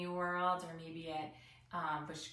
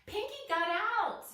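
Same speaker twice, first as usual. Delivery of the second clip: high, loud urgent call; excited cry; 13 semitones higher, 6 dB louder than usual. A narrator's reading. Teacher voicing Penny warning classmates, high loud urgent call. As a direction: blurt it out, alarmed.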